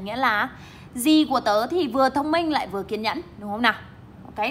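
ordinary delivery

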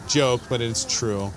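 ordinary talk

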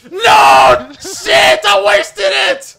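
high, full-force scream of frustration; anguished shout. Real, not acted. Player who wasted a shot, anguished shout. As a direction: scream it, dragging out the words.

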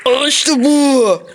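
high, drawn-out cry of relief; excited relief. Real, not acted. Gamer telling viewers he found the kit, stretched high excited cry. Finds the medkit mid-fight.

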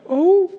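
high-pitched "oh" imitating the wife; a high little oh of delighted interest; wife's reacting oh. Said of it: "Polly would do this".

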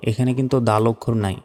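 ordinary delivery

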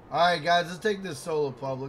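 loud, hyped call to the guys; loud announcement. Real, not acted. Streamer rallying his viewers, loud and hyped. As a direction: announce it big to the crew.

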